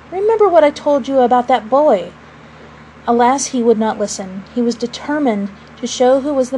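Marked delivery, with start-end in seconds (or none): none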